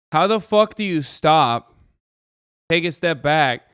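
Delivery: loud, high, drawn-out mock outrage; mock outraged scolding. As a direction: tell someone off in exaggerated disbelief. Mock scolding of the woman in story.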